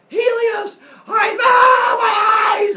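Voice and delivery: put-on high, very loud child shriek; hammed-up scream, last words stretched out; yelling. Boy bursting into the blinding palace, high screamed yell. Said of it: "steps in and yells".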